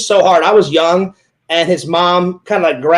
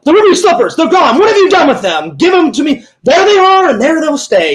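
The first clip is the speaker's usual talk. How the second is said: movie villainess; 8 semitones higher than usual. Acted. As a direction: act out a demanding villainess, pitch way up. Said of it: "She's like".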